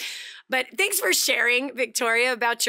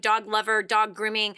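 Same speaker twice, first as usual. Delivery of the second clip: ordinary talk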